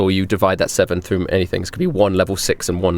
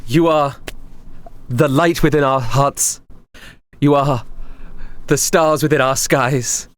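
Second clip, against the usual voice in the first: groveling worshipper; 4 semitones higher than usual. Acted. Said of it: "Pomo's, like, groveling on her knees".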